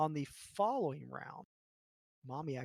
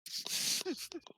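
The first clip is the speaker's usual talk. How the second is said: high breathy laugh. Real, not acted. GM laughs after the bite.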